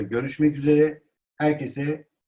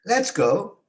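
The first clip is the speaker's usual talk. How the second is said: high-pitched rallying call; exclamation; 8 semitones higher than usual. Shown as a demonstration. Teacher models calling others to go, exclaimed. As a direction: call them to get moving. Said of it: "ünlem demek haykırmak demek".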